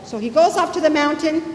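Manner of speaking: normal talking voice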